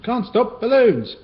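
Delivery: high, loud hurried call; breathless hurry. Acted. Kipper dashing off to get balloons, breathless. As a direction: rush off in a hurry. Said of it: "he panted".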